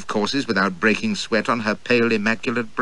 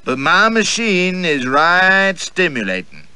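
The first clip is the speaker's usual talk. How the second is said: slow, higher, lingering drawl; 9 semitones higher, 5 dB louder, slower than usual. Acted. Tilda to the narrator, slow coy drawl. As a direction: drawl it out coyly. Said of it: "drawls the fair Tilda".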